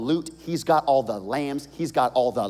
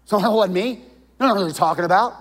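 a touch of loud, higher denial; defensive denial; 7 semitones higher, 7 dB louder, faster than usual. Acted. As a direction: brush it off indignantly. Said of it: "Maybe you deny the whole thing".

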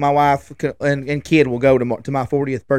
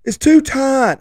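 put-on high whiny kid voice; whiny kid complaint; 11 semitones higher, 4 dB louder, slower than usual. Son complaining about his car seat straps, high whining kid voice. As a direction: complain like a fussy little boy.

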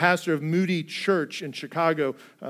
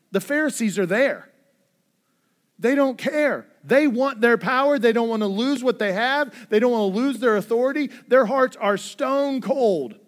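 louder, higher preaching voice, driving each line home; full-force preaching; 4 semitones higher, 5 dB louder than usual. Acted. Pastor warning his congregation against sin, loud fervent preaching.

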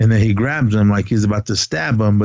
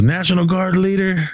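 higher, lightly voiced officer ultimatum; a pompous commander issuing orders, voice raised in pitch; officer on megaphone; 8 semitones higher than usual. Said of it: "And he goes".

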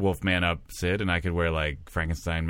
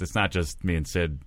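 ordinary delivery

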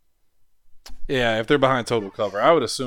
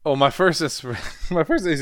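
ordinary talk

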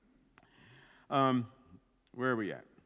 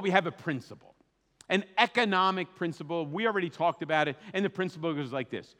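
normal talking voice

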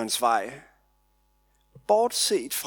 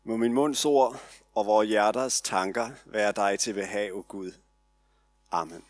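prayer; 4 semitones lower than usual. Acted. Opens the sermon in prayer.

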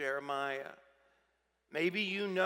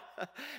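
mocking laugh; 8 semitones higher, 10 dB quieter than usual. Passersby laugh at Jeremiah.

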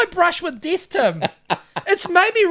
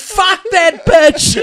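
slow loud mock snarl; 7 dB louder, much slower than usual. Mock rant about politician.

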